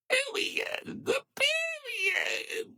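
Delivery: very high, airy squeak; tiny high falsetto squeak; squeaky period. Shown as a demonstration. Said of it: "I gotta do it like this in my face".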